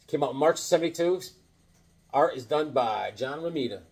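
normal talking voice

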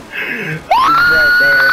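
drawn-out yell, high and loud; excited shout. Real, not acted. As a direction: yell it out, stretched long. Close to catching him in game.

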